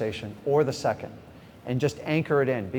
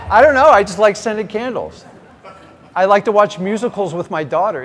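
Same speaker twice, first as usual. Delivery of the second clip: high, loud, giddy defense; sheepish excited protest; 5 semitones higher, 8 dB louder than usual. Retold or imitated. Husband answering his wife about candles, giddy and loud. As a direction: squeal it out like a caught kid. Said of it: "I go".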